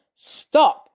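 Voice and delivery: loud, high shout; sharp loud command. A narrator's reading. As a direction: cut them off with a yell. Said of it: "Steve shouts".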